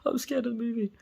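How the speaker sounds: squeaky imitation of a frightened girl; high girlish mimic. Telling friends how his girlfriend whimpered, high mock voice. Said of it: "She was like".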